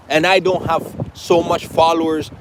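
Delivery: ordinary talk, nothing marked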